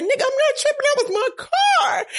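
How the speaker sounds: high, loud, slowed retort; indignant retort. Retold or imitated. Answering the couple who doubted it was his car, indignant high retort. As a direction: snap back in disbelief. Retold snapping back at couple.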